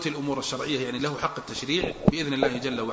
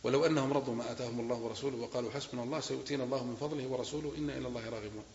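measured, softer recited verse; Quran recitation; 3 semitones lower, 9 dB quieter than usual. Acted. Sheikh reciting the verse to his students, recited. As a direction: lay the verse out as scripture, unhurried.

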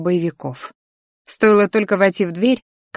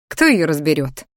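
ordinary delivery

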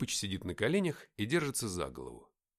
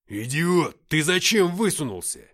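loud, raised angry rebuke; angry scolding; 2 semitones higher, 9 dB louder, slower than usual. Acted. Comrade berates the wounded man, angry shouting. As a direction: yell at him for being so reckless.

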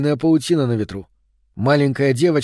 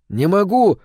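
put-on a higher calling voice, boy at the window; call back; 6 semitones higher, 3 dB louder, slower than usual. A narrator's reading. Boy at the window calls back to the girl, higher. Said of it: "крикнул он в ответ".

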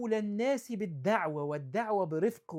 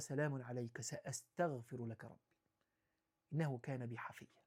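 much softer and lower, a peaceful answer; gentle farewell; 7 semitones lower, 11 dB quieter than usual. Acted. Son answering his harsh father softly and lower. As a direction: let it fall calm and tender.

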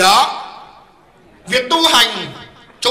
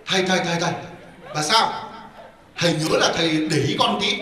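low, softer aside to the monk; furtive request; 7 semitones lower, 7 dB quieter than usual. Retold or imitated. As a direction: imitate a hushed, discreet plea. Woman discreetly asks monk for a cue.

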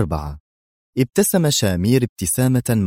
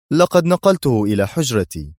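plain reading voice

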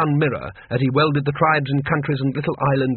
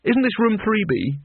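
bright; 8 semitones higher than usual. Acted. Rincewind bluffs brightly.

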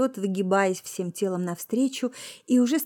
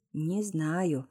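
low, quiet, slightly husky answer; subdued husky reply; hoarse reply; 4 semitones lower, 6 dB quieter than usual. A narrator's reading. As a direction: drop the voice, a touch hoarse. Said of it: "хрипло сказал Виктор".

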